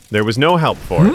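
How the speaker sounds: usual speaking voice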